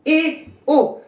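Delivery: "I, U" as long, high, held vowels; teacher modelling "I", then "U", each sustained; vowel demonstration. Shown as a demonstration. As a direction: draw out "I" and "U" on a high steady pitch. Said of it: "las vocales débiles en espagnol son".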